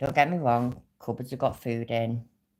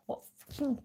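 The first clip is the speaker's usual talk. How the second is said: whispered; 12 semitones higher, 8 dB quieter than usual. Real, not acted. Ranting, drops to whisper.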